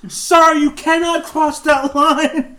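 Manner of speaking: high, loud, slow warning shout at a tourist; retold guard yelling. Guard shouting at the guy crossing the volcano line, loud and slow. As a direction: call out sternly to stop him crossing. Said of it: "you hear".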